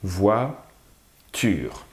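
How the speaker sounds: "voiture" broken into "voi", pause, "ture"; split into syllables. Shown as a demonstration. Teacher asking for the syllable count, "voiture" in two separated syllables. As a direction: say "voiture" slowly, one syllable at a time. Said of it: "Combien de syllabes au mot voiture?".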